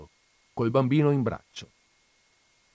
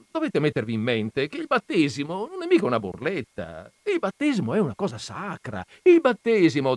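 rising, insistent protest, getting louder; lightly voiced indignant priest; 7 semitones higher, 6 dB louder than usual. A narrator's reading. Don Camillo defending himself to Jesus, rising indignation. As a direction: argue your case, building to a near shout.

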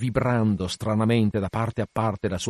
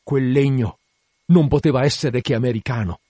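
raised-voice exclamation, somewhat louder; Acab crying out; 4 semitones higher, 7 dB louder than usual. A narrator's reading. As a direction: call it out in dismayed realization. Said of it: "urlò Acab dalla barca".

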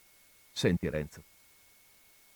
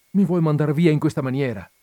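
pleading; 6 semitones higher, 7 dB louder than usual. Acted. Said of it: "in atto supplichevole".

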